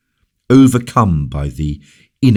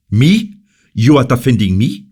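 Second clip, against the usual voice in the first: high, louder sharp surprise; startled; 8 semitones higher, 5 dB louder than usual. Acted. The king to La Valliere, startled and loud. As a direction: caught completely off guard.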